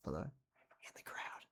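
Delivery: whispered aside. Acted. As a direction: breathe it like a secret.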